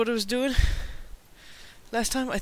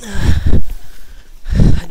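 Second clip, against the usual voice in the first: slow frustrated groan; 13 dB louder than usual. Real, not acted. Frustrated after dying.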